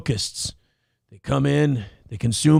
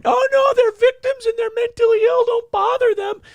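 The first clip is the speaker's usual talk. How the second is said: shrill-free but very high, louder pleading falsetto; 4 dB louder than usual. Acted. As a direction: squeak it out like a fretting do-gooder. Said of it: "you got [name] over here going".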